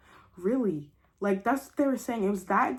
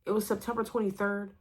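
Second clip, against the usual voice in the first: usual speaking voice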